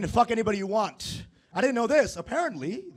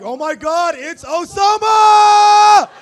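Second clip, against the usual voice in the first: full-volume scream across a crowded airport, last word held long; screaming the name; 12 semitones higher, 14 dB louder, much slower than usual. Retold or imitated. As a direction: yell it high and stretched, overjoyed to spot a friend. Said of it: "he screams my name".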